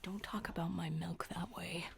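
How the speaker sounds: hushed mock-offended whisper. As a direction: whisper it, scandalized.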